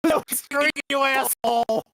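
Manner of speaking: high, loud mock-outraged yell; flustered outburst. Real, not acted. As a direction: shout back, flustered by the teasing. Flustered by the cowboy voice.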